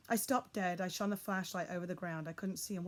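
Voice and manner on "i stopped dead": plain reading voice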